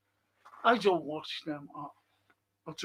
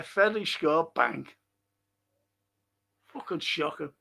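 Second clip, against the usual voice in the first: ordinary talk, nothing marked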